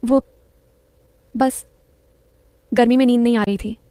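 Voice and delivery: hesitant, long gaps between first words; halting, mildly flustered reply; stammering. A narrator's reading. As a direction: falter over an awkward excuse. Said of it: "वो हकलाया".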